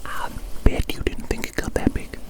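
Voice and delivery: ordinary delivery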